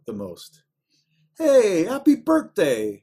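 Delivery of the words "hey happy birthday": slow, loud, high "birthday" with the H dropped and a hard T; mock Chicago "birthday". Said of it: "the one phrase where it comes out".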